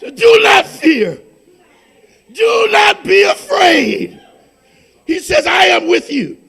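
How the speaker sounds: loud, fervent pulpit proclamation; preaching at full force. Acted. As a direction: thunder the scripture over the congregation. Preaching testimony climax.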